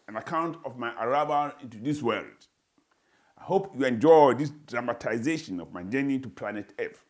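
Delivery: ordinary delivery, nothing marked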